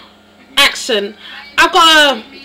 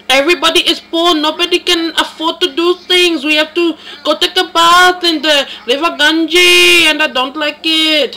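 lightly voiced Indian accent, scolding and insistent; a mild Indian accent, wagging a finger at the listener; put-on Indian accent, complaining about home; 2 semitones higher than usual. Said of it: "Prakta from, um, New Delhi".

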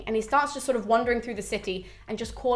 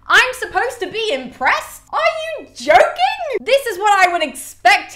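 loud, very high, slow incredulity; outraged disbelief; 11 semitones higher, 7 dB louder, slower than usual. Acted. Reader reacts to Aeneas's majestic stride, incredulous. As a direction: drag out the indignant questions.

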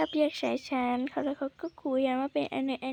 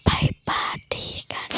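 louder stage whisper; urgent louder whisper; 5 dB louder than usual. Acted. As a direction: push the whisper so it carries. Secret invitation repeated.

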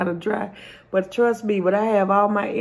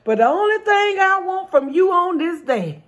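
indignant swimmer; 6 semitones higher than usual. Acted. Swimmer's punchline demand.

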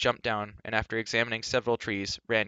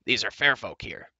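declaring; 4 dB louder than usual. Acted. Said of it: "he declared".

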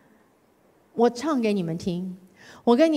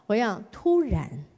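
usual speaking voice

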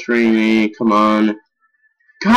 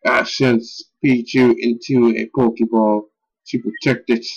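ordinary delivery

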